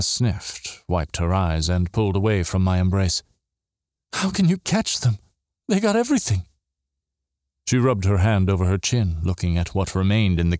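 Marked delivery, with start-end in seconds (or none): 4.1-6.5 high, despairing protest; distressed question. A narrator's reading. Sister after the robbery doubting the rescue, distressed. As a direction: ask it high-pitched and upset.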